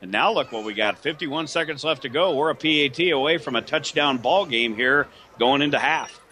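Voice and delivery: normal talking voice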